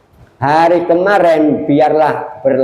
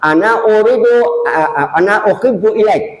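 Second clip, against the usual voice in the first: imitating Arabic speakers; 6 semitones higher than usual. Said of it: "Nah, sama saja".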